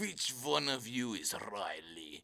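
low, slow stern teacher impression. Teacher hunting for Riley, slow low mock voice. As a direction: drag the question out like the teacher. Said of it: "He's like".

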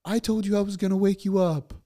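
high-pitched put-on child voice; imitated daughter. Dad mimicking his daughter's morning complaint, high child voice. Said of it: "she comes out, and she goes".

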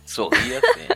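laughing. Pretend. Mock chant, laughing.

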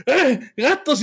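high mock yell of "¡Ah, gatos!" as the wife retells it; mock cry of alarm; mimicked yell. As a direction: imitate his startled shout, playfully. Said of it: "tu pinche grito de".

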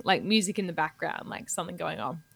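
normal talking voice

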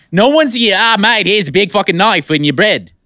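mock Aussie bloke, Australian accent. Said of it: "How's that accent?".

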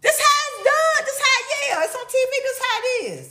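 exasperated, mocking the repetition; exasperated mock retort. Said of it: "I'm like".

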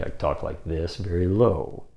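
suddenly low voice. Shown as a demonstration. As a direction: drop the voice down low. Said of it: "I can lower my pitch".

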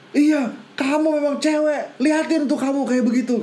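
mock furious telling-off; acted angry shouting. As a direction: imitate an exasperated father yelling. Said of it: "akhirnya dibentak sama bapaknya".

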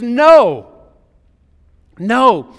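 loud, ringing No, No; emphatic no. Acted. Paul answers the doubters with a loud emphatic no. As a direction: shout the answer with full conviction. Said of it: "he answers it emphatically".

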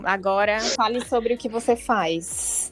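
ordinary delivery

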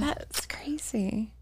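slow, soft whispered aside; whispering. Real, not acted. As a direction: breathe it out in a hushed whisper. Whispered reaction.

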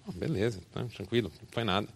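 quiet, easygoing no problem; a gentle, hushed it's fine; soft reassurance. Acted. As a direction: shrug it off softly. Brother brushes off the apology.

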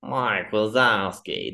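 slow, drawling bored receptionist; Monsters Inc desk lady. Retold or imitated. Desk lady calling out name, slow and bored. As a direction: drag the name out like a bored clerk. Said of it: "like the lady in Monsters, Inc".

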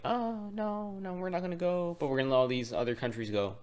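squeaky high mocking voice. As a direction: pitch it way up, mocking. Mock quote, high squeaky voice.

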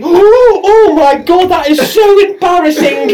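very high, loud mock embarrassment; hammed-up mortified exclamation. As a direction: squeal it out, laughing at the end. Acting out friend caught singing.

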